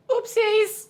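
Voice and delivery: high squealed oopsies; playful oops. Real, not acted. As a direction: squeak it out after spilling. Spills champagne, high squeal.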